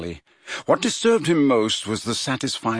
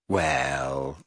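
low, softer doubtful Well; unconvinced; 8 semitones lower, 5 dB quieter than usual. A narrator's reading. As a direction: drag out the doubt. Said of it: "sounding thoroughly unconvinced".

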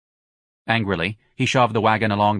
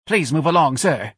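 higher, prim lady's plea; bold lady; 7 semitones higher, 3 dB louder than usual. A narrator's reading. A bold lady tells Ignatius to move, firm. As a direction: ask him firmly to leave. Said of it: "a bold lady said".

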